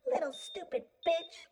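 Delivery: high, snide jeer; snickering. A narrator's reading. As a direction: jeer it with a high, mocking lilt. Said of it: "I heard her snicker".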